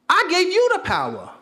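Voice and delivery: emphatic. Shown as a demonstration. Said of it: "repeated louder".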